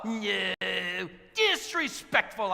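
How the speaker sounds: slow, firm disapproval; Perry scoffing at the NFL; disapproving. Retold or imitated. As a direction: draw the word out in disgust.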